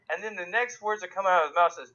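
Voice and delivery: ordinary talk, nothing marked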